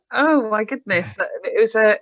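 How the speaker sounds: ordinary delivery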